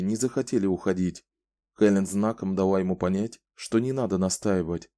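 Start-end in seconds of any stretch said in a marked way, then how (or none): none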